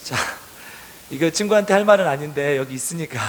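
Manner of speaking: ordinary delivery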